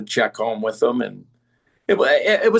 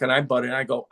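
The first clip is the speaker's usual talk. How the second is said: normal talking voice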